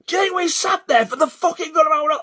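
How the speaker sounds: high, loud complaint; retold angry shout. Arguing over a stolen parking spot, re-enacted angry shout. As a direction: re-enact a furious parking row.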